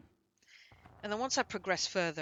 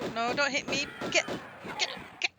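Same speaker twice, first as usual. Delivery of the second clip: panicked; 9 semitones higher, 6 dB louder than usual. Real, not acted. Enemies attacking in game.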